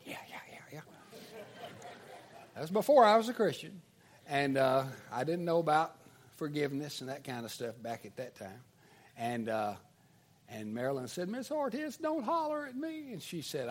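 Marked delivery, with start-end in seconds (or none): 0.0-0.8 whispered mock gloating; quiet, private little taunt; gloating under breath. Accused boy gloats under breath. 11.3-13.1 high, small pleading voice; protesting schoolgirl. Retold or imitated. Scolded pupil protests, acted.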